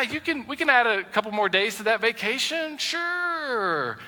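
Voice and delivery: high, slow, hammed-up yes-man; mock indulgent husband. Preacher mimics a husband letting his wife spend, mock indulgent. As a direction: play the spineless husband giving in. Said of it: "You look at her and you say".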